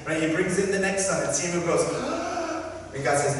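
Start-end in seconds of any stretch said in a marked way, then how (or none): 1.9-2.9 a sharp high gasp of excitement; excited gasp. Acted. Samuel gasps excitedly at the next son. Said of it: "Samuel gets all excited".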